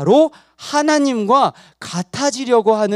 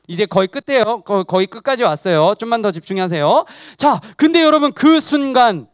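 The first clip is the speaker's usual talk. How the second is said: usual speaking voice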